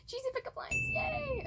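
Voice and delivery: high squealy cheer; excited cheer. Real, not acted. Cheering before the pickup lines.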